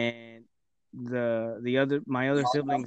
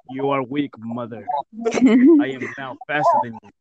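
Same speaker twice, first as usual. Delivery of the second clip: mock movie hero; 4 semitones higher, 4 dB louder than usual. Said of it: "looked at your mom".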